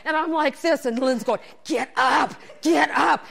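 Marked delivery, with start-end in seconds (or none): 1.7-3.3 louder imitated friend egging you on; urgent urging. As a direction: shout your pal up off the seat. Friend urges her up while pedaling.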